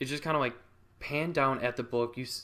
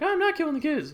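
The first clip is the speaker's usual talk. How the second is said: high, loud hammed-up denial; Sarah; 6 dB louder than usual. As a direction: protest in a put-on high voice. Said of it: "And she's like".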